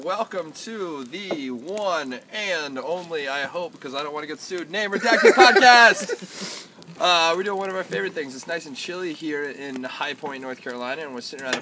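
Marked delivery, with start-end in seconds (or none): 4.7-6.7 announcer. Acted. Show intro announcement.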